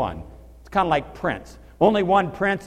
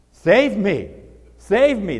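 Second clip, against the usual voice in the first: acted cry for help; 4 semitones higher, 8 dB louder, much slower than usual. Peter drowning cries out.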